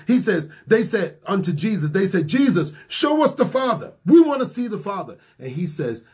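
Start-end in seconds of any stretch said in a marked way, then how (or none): 2.3-5.2 high, pleading cry of the disciples. Retold or imitated. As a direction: beg to see the Father, voice lifted high.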